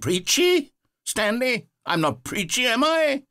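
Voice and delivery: shocked and hurt at the criticism; offended surprise. Acted. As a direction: jolt up high, wounded by the word. Narrator offended by the review.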